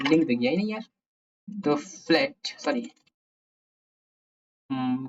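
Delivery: normal talking voice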